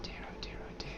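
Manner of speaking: soft, breathy whisper of dismay. Real, not acted. Streamer whispers dismay to himself after a setback. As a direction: whisper it under your breath.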